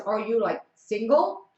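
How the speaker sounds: usual speaking voice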